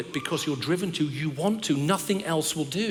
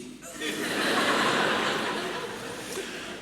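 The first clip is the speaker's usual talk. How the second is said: high airy laugh; 13 semitones higher than usual. Real, not acted. Preacher laughing at his own joke to the church. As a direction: laugh at your own punchline.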